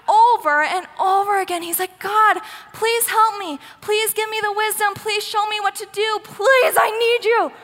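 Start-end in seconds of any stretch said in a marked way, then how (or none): 2.0-7.5 high, urgent begging prayer, acted; desperate plea. Saul begging God for help, desperate mock prayer. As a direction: beg God with rising desperation, drawing out the plea. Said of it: "Saul is desperate at this point".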